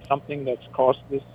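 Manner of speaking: normal talking voice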